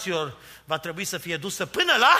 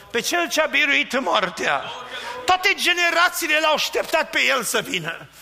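raised, higher preaching voice; fervent preaching; 5 semitones higher, 4 dB louder than usual. Acted. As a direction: lift it, proclaiming the victory over death. Preacher rises again. (predică înflăcărată, cu vocea ridicată)

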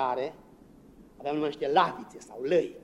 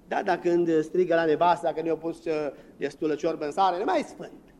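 ordinary delivery

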